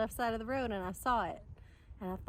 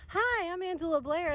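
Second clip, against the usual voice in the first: bright eager greeting; 8 semitones higher, 4 dB louder than usual. Retold or imitated. Nervous pitch to strangers.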